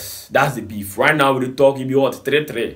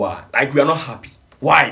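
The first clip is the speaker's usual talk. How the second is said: ordinary delivery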